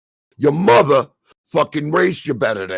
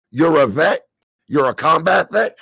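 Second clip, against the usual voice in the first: ordinary talk, nothing marked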